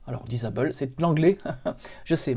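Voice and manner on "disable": English word with a French accent; accent français en anglais. Real, not acted. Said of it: "mon accent anglais vous fait toujours rire". (accent français sur le mot anglais)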